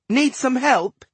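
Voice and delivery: high, slightly unsteady offer; understated wobble in a girl's voice; shaky voice. A narrator's reading. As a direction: lift it high, bravely covering upset. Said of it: "she said in a shaky voice".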